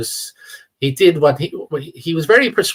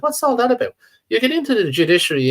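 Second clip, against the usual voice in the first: incredulous; 9 semitones higher, faster than usual. Real, not acted. Outraged rhetorical question.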